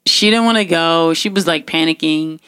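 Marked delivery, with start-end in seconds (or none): none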